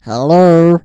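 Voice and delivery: loud phone hello. Pretend. Prank call phone greeting.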